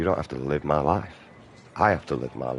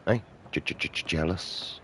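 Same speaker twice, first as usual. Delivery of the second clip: mock stammer; 8 dB quieter than usual. Taunting a hater in chat, a soft mocking stammer. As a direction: stutter it out, mocking. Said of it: "I'm jealous much".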